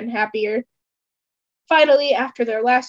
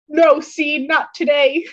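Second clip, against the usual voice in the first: louder plea, refusing to let go; desperate cry; 4 semitones higher, 5 dB louder, slower than usual. Acted. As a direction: call it out, begging. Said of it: "Justin cries".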